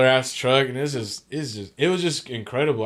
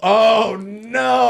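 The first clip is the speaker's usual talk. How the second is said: mock dismay; 10 semitones higher, 7 dB louder than usual. Retold moment after throwing up.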